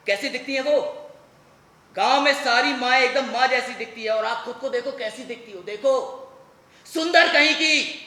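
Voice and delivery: loud tirade building to a shout; mounting angry outburst; furious accusation. Acted.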